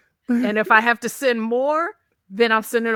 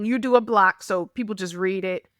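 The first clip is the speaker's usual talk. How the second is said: ordinary talk, nothing marked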